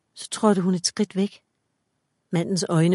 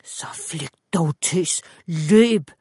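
angry shout; much slower than usual. A narrator's reading. Man orders Mia to flee, shouting angrily. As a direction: start in a forced whisper, burst out on the last word. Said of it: "råbte han vredt".

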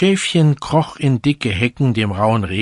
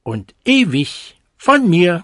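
higher, louder smug vow; storybook cuckoo, higher and louder; vain cuckoo; 7 semitones higher, 5 dB louder than usual. A narrator's reading. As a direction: cuckoo boasting he'll praise himself. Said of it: "fuhr er fort".

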